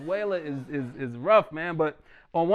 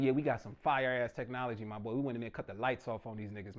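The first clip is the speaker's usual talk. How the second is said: lower, softer boastful Trump quote; bragging; 8 semitones lower, 6 dB quieter than usual. Retold or imitated. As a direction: brag low and casual about your tech. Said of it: "Trump was bragging".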